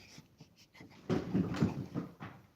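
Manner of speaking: loud derisive laugh; a loud taunting burst of laughter; mocking laugh. Real, not acted. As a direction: laugh right at them, scornful.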